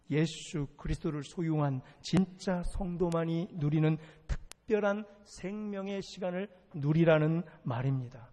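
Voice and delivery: ordinary talk, nothing marked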